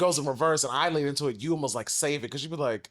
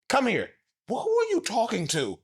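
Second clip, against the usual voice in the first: mock intense call; 11 semitones higher than usual. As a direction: ham up his intense come-here. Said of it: "I don't say it like that".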